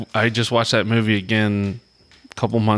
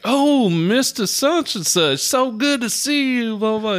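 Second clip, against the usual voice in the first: put-on very high, drawn-out old-time maid voice; put-on mammy voice; 14 semitones higher, slower than usual. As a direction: sing it out like a movie housemaid overplaying it. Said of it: "she was super putting on the, the fucking mammy thing".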